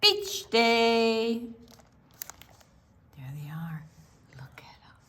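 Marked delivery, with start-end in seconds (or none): 3.2-5.0 soft hushed pointing; whisper. A narrator's reading. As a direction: whisper like spying. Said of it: "unvoiced whisper".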